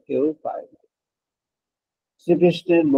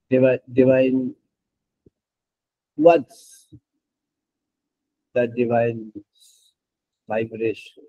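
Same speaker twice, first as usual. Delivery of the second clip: slow, reverent, savouring every word; very slow drawn-out; much slower than usual. Acted. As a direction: stretch each English word out very slowly. Slow, drawn-out English devotional phrases.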